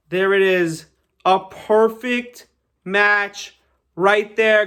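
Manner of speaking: loud, drawn-out showman's announcement; triumphant reveal. Real, not acted. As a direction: stretch out the big reveal for applause. Triumphant final reveal of match.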